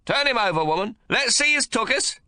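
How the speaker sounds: put-on high, loud, bossy old grandfather; gruff domineering old man. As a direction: bark orders at the new mother. Old man voiced in story.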